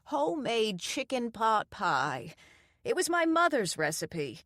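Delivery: a hint of a proud old cook; proud old lady. A narrator's reading. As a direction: present it proudly like a grandmother. Doreen presents pie.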